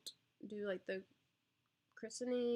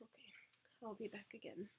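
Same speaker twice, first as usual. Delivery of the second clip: hushed whisper; 10 dB quieter than usual. Real, not acted. Whispering near sleeping baby.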